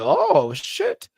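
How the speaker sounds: high, startled gasp of a curse; shocked. Retold or imitated. Vlogger recalling a surprise lip sync, high shocked curse. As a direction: react like you got caught off guard. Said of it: "I was like".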